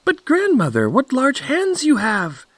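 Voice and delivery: put-on very high, excited little girl; astonished Little Red Cap. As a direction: exclaim in mounting wonder, pitched high. Red Cap marvels at grandmother.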